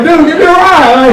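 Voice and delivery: mock shout. Comedian begging passing drivers for a ride, mock shout. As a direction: yell after the car.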